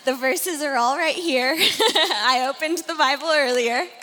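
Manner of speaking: amused, talking through a laugh. Real, not acted. Speaker joking to audience about the Bible, laughing. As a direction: let a chuckle break into the words.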